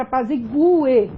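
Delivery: apazigue as a-pa-zi-GU-e; hiatus, stressed U. Shown as a demonstration. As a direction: stress the U in apazigue, apart from the final e. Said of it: "você tem uma separação ali".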